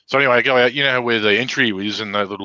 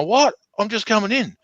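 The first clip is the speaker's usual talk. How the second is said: high mock innocence; 11 semitones higher than usual. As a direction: voice jumps way up, playing innocent. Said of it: "And you go".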